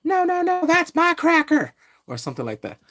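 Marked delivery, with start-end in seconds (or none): 0.0-1.7 high, squeaky mock bickering over an apple; mock bum argument. Mimicking two bums bickering over food, in a high squeaky voice. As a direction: squabble like a silly bum. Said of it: "two bums get in an argument".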